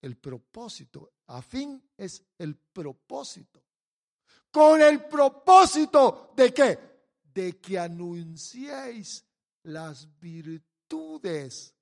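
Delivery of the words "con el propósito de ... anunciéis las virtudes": loud slow question then slow answer; preacher pressing the point slowly; emphatic preaching. Acted. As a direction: drive home the purpose. Preacher stressing purpose.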